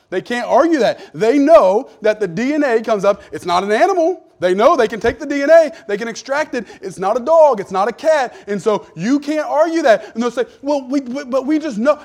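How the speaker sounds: loud, high fiery sermon; fiery pulpit climax; full-force preaching. Acted. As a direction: preach it hard to the crowd.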